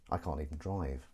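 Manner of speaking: low, subdued mumble; quiet admission. Acted. Host admitting he can't drive, quiet admission. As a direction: drop it low and soft. Says it low, then repeats louder.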